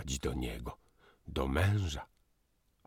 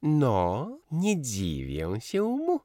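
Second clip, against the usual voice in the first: light interjection; 10 semitones higher, 4 dB louder, slower than usual. A narrator's reading. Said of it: "lekko wtrąciła pani Szczerkowska".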